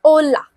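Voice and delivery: ओला said "o-la", no h at the start; hola with silent h. Shown as a demonstration. Teacher reads Spanish hola aloud, h silent. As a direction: drop the h, just "ola". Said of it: "तो बाकी क्या हो गया".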